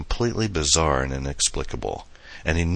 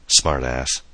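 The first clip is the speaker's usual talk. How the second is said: ordinary delivery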